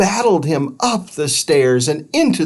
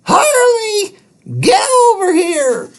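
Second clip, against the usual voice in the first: much higher, loud shout across the yard; calling the dog; 5 dB louder than usual. Acted. Missus Scuttle calling Harley home, loud shout. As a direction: summon the dog sharply.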